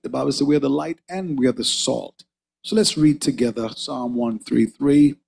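ordinary delivery

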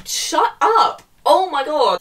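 loud, high burst of annoyance; real exasperated yell; exasperated outburst. Real, not acted. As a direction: snap loudly at the character, fed up. Fed up with the character.

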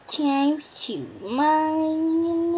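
very slow drawn-out line; slow hammed-up announcement. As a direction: stretch every word. Dramatic game narration.

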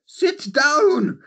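high, loud mock Trunchbull bark; barked order. Imposing wife roars at the nervous suitor, barking. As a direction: bellow it like a fearsome headmistress.

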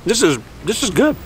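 talking while chewing food; words round a mouthful; mouth full. Real, not acted. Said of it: "you shouldn't talk with your mouth full".

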